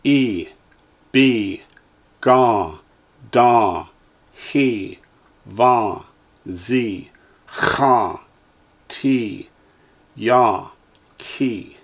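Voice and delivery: each syllable said slowly and clearly, with a pause after it; teacher's careful drill voice; Hebrew syllables for echoing. Shown as a demonstration. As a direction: model every Hebrew sound for the class to repeat. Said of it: "Line nine".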